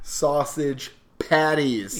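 very slow, drawn out; savoring slowly. Acted. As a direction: linger over each word. Savoring the words.

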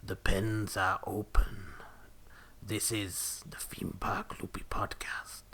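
slow, ominous whispered announcement; spooky intro. Shown as a demonstration. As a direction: whisper it like a horror intro, drawn out. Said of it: "it'll be something like".

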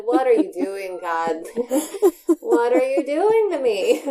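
loud laughing plea to the heavens; half-laughing outcry; exasperated cry to God. Retold or imitated. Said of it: "I just said".

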